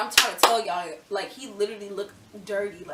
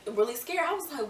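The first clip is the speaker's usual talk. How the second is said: ordinary talk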